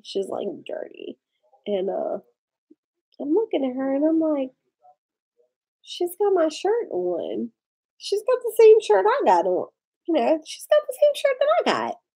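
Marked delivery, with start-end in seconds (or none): 0.7-1.2 a hushed, unvoiced repeat; softer whispered echo. Repeats the word in a hush. 5.8-12.0 high-pitched, incredulous retelling of her own shock; stunned at a stranger wearing her shirt; shocked disbelief. Retold or imitated. Acting her own shock at the door.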